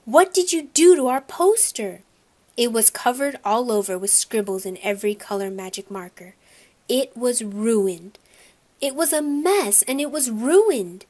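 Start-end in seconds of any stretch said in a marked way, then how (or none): 0.1-2.0 high, loud outraged cry; yelled. A narrator's reading. Boy yells at little brother over ruined poster, outraged. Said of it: "I yelled". 8.8-11.1 higher-pitched, exasperated narration; upset. A narrator's reading. Boy laments his ruined poster, upset and exasperated.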